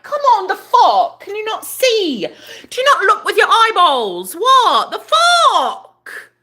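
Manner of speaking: high, loud, drawn-out outrage; exasperated rant. Real, not acted. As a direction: yell at the absent culprit, stretching the last words. Ranting at roommate's unwashed spoons.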